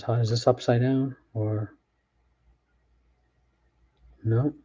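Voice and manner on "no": ordinary delivery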